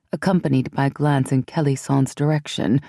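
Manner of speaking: plain reading voice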